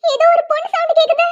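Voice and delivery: urgent shout for help; alarmed calling out. Acted. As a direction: rouse everyone to run over. Alarmed, calling everyone over.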